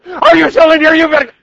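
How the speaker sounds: very high, very loud shriek; hammed-up furious mom scream; screaming mother. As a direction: scream at the child, exploding. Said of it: "To ignore her until she screams".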